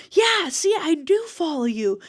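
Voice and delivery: imitating a delighted new fan; excited confirmation. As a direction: cheer it like you just proved it. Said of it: "She's like".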